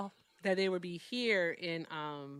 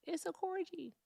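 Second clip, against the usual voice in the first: ordinary delivery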